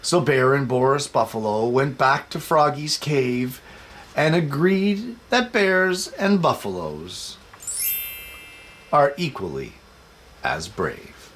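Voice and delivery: put-on lilting read-aloud for children. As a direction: read it like bedtime to little kids. Reading picture book aloud.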